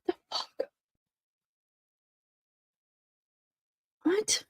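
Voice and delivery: barely voiced disbelief; stunned whisper. Real, not acted. Streamer alone with surprising trial news, stunned whispering. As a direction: whisper it to yourself, dumbfounded.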